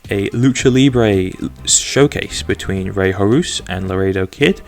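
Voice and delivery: ordinary talk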